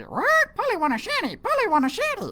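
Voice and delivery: high, squawky parrot voice; squawking parrot. Acted. Parrot squawking back at the pirate captain.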